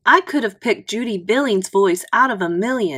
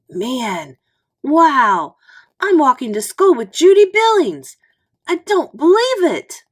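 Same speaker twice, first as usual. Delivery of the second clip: higher and louder, dazzled disbelief; amazed thought; 6 semitones higher, 6 dB louder than usual. A narrator's reading. Boy walking with his crush, thinking in starstruck amazement. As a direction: gush it to yourself, starstruck.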